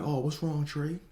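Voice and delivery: high, sugary fake-sympathy voice; mock concern. Prank caller faking concern before the jab. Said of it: "they'd be like".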